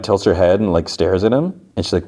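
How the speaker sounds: ordinary talk, nothing marked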